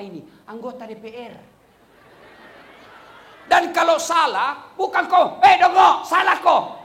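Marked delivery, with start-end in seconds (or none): 5.4-6.8 loud, scolding heckle in imitation; mock rude shout. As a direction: bellow it like a rude congregant correcting the imam. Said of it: "jangan kau teriak di sana".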